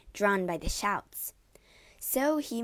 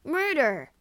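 high, loud falling cry; alarmed yell; 7 semitones higher, 6 dB louder than usual. A narrator's reading. Wounded man raises the alarm, yelling. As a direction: yell the alarm to anyone near. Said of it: "yelled Cassio".

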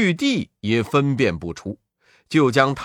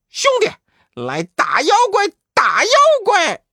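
monkey battle cry; 5 dB louder than usual. Acted. Both Wukongs call to Pigsy.

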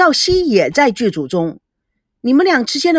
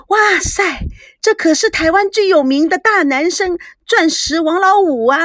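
high, louder, thrilled gushing; loud excited exclaim; 8 semitones higher, 3 dB louder than usual. A narrator's reading. Yuki pointing at the TV host, loud and thrilled. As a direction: point and shout it with delight. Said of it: "Yuki 指着赵维康大声地说".

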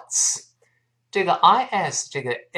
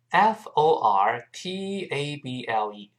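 usual speaking voice

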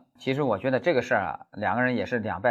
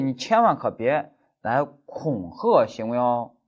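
ordinary talk

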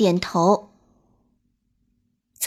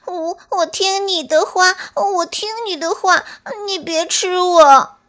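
high, stammering, hurried plea; a small animal rushing to agree; squeaky voice begging not to be eaten; hasty little squirrel; 8 semitones higher, 3 dB louder than usual. Acted. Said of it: "连忙说".

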